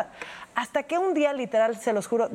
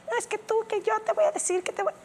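much higher, rushed whiny gibberish; mocking the ex's babble; 11 semitones higher than usual. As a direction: parody him tripping over his words. (parodia del ex, mucho más aguda y atropellada)

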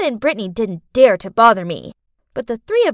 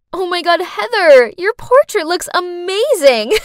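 loud sarcastic gushing; mocking fake praise; 7 semitones higher, 5 dB louder than usual. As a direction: gush with fake admiration. Mean girl mocking praise.

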